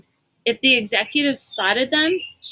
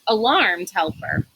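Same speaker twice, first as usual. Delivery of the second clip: ordinary delivery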